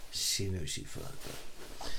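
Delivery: slow, breathy whisper under the breath; whispered aside. Real, not acted. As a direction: drop to a hushed, slow whisper. Quiet aside about girlfriend.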